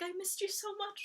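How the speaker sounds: soft, high and tearful; tender. Retold or imitated. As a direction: say it softly, on the edge of tears. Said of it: "And he's like".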